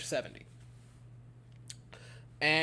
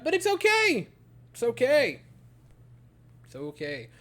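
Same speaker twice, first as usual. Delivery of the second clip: fading self-reassurance; 5 semitones higher, 3 dB louder than usual. Real, not acted. Fan calming himself as the inning unravels, fading reassurance.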